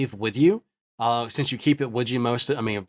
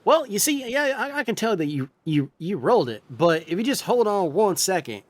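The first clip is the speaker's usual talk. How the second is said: higher, brighter sailor voice; eager crewman; 7 semitones higher than usual. Acted. Healer answering the captain's call. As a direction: rush in ready to help. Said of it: "he's just like".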